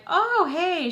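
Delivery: high, loud forced-nice hey; mock sweet greeting; fake friendly hi. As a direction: greet her with fake cheer. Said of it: "and I'm like".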